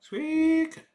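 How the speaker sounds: very high squeaky squeak; squeaky rubber duck. Acted. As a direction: squeeze out a tiny toy squeak. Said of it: "Tell me".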